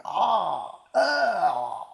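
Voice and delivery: put-on a high, laughing, over-the-top Australian accent. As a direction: imitate an Aussie pub regular, giggling through it. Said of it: "what they really sound like".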